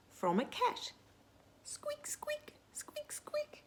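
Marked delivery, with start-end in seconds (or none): 1.6-3.6 very high, tiny squeaking mice voice; frightened mice. Acted. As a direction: squeak like scared little mice. Mice hiding from a cat.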